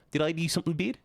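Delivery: New Zealand vowels, pitch climbing on "bad"; New Zealand accent. Shown as a demonstration. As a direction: ask "bad" with a rising New Zealand lilt. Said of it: "they always ask questions at the end of every sentence".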